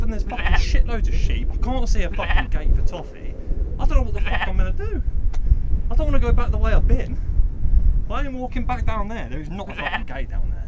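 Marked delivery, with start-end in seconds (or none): 0.0-9.3 high, frustrated complaining; exasperated. Real, not acted. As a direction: vent at the missing gate. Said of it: "pissed off".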